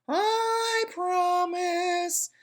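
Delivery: high, slow sly coaxing; coaxing pigtail. A narrator's reading. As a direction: drawl it out to seduce him. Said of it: "said the pigtail".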